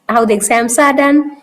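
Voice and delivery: ordinary delivery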